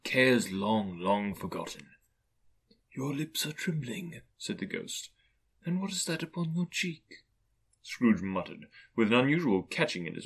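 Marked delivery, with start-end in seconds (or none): 2.9-4.2 soft, higher spirit voice; hushed ghostly tenderness; gentle ghost. Acted. Ghost gently observes Scrooge's emotion. 5.6-7.2 soft, higher spirit voice; hushed ghostly tenderness; gentle ghost. Acted. Ghost gently questions Scrooge.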